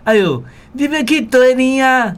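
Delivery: high, sweet and friendly, voice lifted; friendly greeting demo. Shown as a demonstration. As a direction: greet someone warmly, as a friendly shopkeeper. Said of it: "足亲切的吼".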